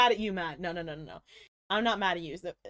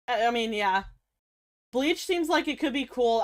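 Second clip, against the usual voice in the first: usual speaking voice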